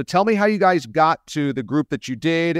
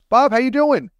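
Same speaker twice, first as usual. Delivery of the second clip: big bright hello, much higher and louder; excited greeting; 12 semitones higher, 6 dB louder than usual. Real, not acted. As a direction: greet him with high energy. Host greets guest on air.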